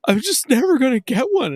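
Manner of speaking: put-on high, loud whiny tearful kid; a boy crying over a lost console; mock whiny kid. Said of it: "And I was like".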